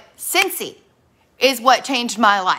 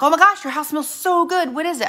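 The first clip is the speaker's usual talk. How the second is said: higher, louder gushing guest; gushing friends; 7 semitones higher, 3 dB louder than usual. Retold or imitated. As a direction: gush over the smell like a guest. Friends gushing over the house smell.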